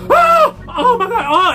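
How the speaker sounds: very high squealing joy; acting out the client's jelly happies. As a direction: squeal with giddy delight. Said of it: "the jelly happies are this".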